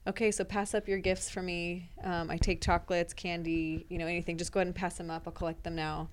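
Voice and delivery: soft, matter-of-fact fake demand; teacher deadpanning a joke request for gifts; deadpan calm. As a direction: say it calmly as if it's routine. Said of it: "very calmly said".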